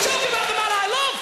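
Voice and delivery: high, impassioned indignant cry; hammed-up indignant defence; mock outrage. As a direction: protest in a high, wounded voice. Mock defending his idol from laughers.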